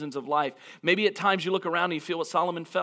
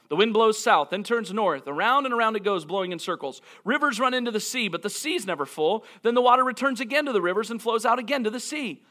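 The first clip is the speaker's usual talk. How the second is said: scripture reading; 4 semitones higher than usual. Acted.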